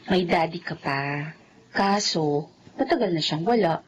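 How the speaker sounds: put-on mother telling child father is gone; gentle sad. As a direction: break it to her gently.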